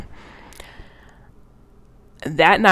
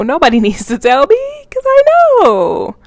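self-mocking wail; 10 semitones higher, 8 dB louder than usual. Real, not acted. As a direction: wail it in comic exasperation, half laughing. Self-mocking wail at own mistake.

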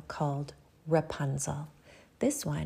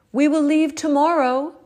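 high, loud call upward; 10 semitones higher, 11 dB louder than usual. A narrator's reading. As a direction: call up to someone above. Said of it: "he called up to his love".

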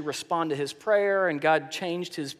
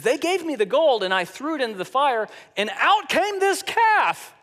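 fast, rising higher to a squeaky calf; hammed-up excuse; 7 semitones higher, 4 dB louder than usual. Aaron, caught by Moses, babbles a mock excuse, fast and rising. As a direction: play the caught kid making excuses.

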